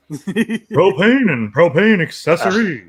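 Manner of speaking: slow, drawn-out Texan propane line; Hank Hill impression. Shown as a demonstration. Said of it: "emphasis on the right syllables".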